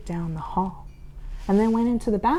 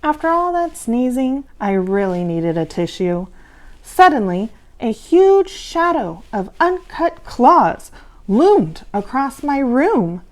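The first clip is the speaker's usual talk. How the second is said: plain reading voice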